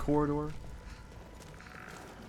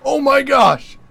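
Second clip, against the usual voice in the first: very high, loud amazement; thrilled; 12 semitones higher, 14 dB louder than usual. Real, not acted. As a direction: shout it in real excitement. Trying the effect again.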